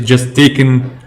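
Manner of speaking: ordinary talk, nothing marked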